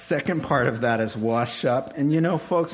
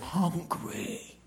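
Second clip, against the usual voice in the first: slow, lightly voiced hungry son; selfish son's thoughts; 4 semitones higher, slower than usual. The son in the pig pen reasons slowly, lightly voiced. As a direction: mull it over slowly, self-pitying. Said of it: "He said".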